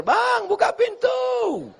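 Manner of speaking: high, drawn-out call to open the door. Acted. Wife arriving home calls to her husband to open the door, high drawn-out call. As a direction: wail it out slowly in a high pitch.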